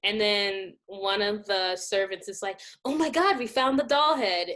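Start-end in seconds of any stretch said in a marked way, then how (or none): 2.8-4.5 higher-pitched imitation of an excited find; excited mock servant. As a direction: announce the big find, pitched up. Acting out servant finding doll head.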